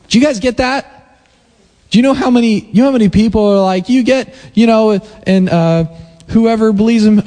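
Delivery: high-pitched, driving sermon cadence; impassioned preaching. Acted. Pastor preaching passionately to his congregation about the kingdom. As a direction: preach it with rising fire. Preaching builds to full force.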